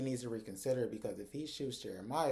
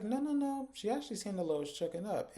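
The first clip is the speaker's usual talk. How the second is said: high protesting; 9 semitones higher than usual. Retold or imitated. Zach protests defending Belinda.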